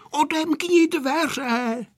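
high, drawn-out call; insistent child's call, slow and high; urgent demand. A narrator's reading. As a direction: call out high for the door to be opened. Children locked in, calling out.

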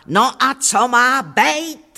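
exasperated near-scream. A narrator's reading. Fed-up housewife answers boastful visitor, straining not to scream. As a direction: throw it out as a defiant, drawn-out retort. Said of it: "abych nezaječela".

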